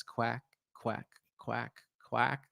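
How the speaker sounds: duck quacking. Pretend. Duck noise for Disney Plus.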